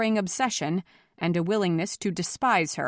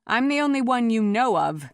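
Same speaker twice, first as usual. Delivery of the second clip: raised, firm retort; calling back; 5 semitones higher, 4 dB louder than usual. A narrator's reading. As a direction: call it back across a crowd. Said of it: "she called back".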